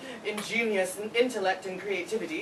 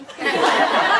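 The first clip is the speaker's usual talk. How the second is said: sudden laugh; 11 semitones higher, 12 dB louder than usual. Acted. Classmate laughs after modest claim.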